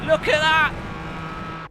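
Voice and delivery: high, slow stretched exclamation; excited drawl. Real, not acted. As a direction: draw it out in delight. Proud of result.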